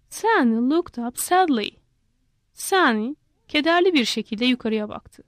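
plain reading voice

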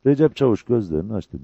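ordinary delivery

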